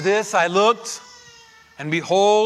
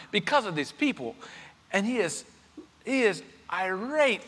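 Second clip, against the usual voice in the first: ordinary delivery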